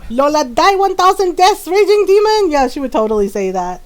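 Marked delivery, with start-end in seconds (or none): 0.1-2.5 high, louder imitation of Lola. Shown as a demonstration. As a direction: play Lola hurling a dramatic curse. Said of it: "Can you say that in your Lola voice".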